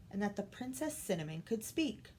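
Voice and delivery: ordinary delivery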